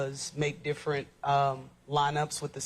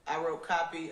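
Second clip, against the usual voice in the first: speaking up; 2 semitones higher than usual. Real, not acted. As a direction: project it to the room. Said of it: "If you could speak up".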